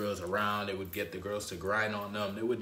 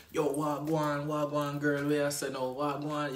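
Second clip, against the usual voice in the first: playful Jamaican patois, higher pitched; Jamaican accent; 6 semitones higher, slower than usual. Retold or imitated. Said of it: "speaking in a Jamaican accent".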